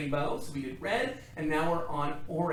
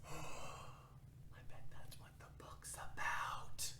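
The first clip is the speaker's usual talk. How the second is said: gasped whisper; 12 dB quieter, much slower than usual. Pretend. Excited secret guess about book.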